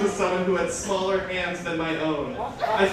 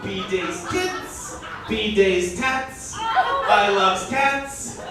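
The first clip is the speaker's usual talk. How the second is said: slow, drawn-out mock dialect verse with laughs breaking through; slow goofy verse, laughing through it; comic poem reading; 6 semitones higher, 5 dB louder, much slower than usual. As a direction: read the silly cat poem slowly, fighting the giggles. Reads own comic dialect poem.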